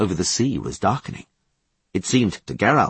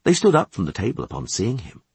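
ordinary delivery

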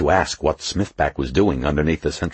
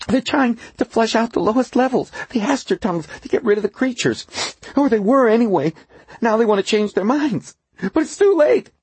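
panicked engineer voice. A narrator's reading. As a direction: blurt the warning in panic. Duffy panicking.

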